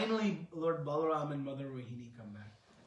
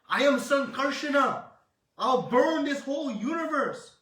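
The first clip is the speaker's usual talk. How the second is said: much higher and louder furious roar, fading at the end; fiery rage; 8 semitones higher, 8 dB louder than usual. Acted. Balaram raging that Krishna was tied up, furious shouting. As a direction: thunder like a god threatening the universe. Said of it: "he becomes very fiery mad".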